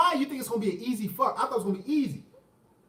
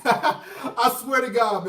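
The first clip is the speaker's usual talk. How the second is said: laughing; 3 semitones higher, 3 dB louder, much slower than usual. Real, not acted. Laughing while retelling her plea.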